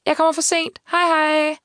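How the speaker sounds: high, light call over the shoulder; called goodbye. A narrator's reading. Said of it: "da hun råbte". (råbt farvel i højt toneleje)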